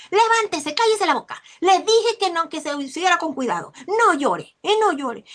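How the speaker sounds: mother yelling at her crying son to get up; angry mother. Retold or imitated. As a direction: snap at the fallen child like a fed-up mother. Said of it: "Y le dice la mamá".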